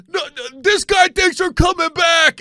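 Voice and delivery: high, loud, slow mock shout; mocking fan. Dallas fan scoffing at the comic, high and loud. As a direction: scoff at the comeback. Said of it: "the guy goes".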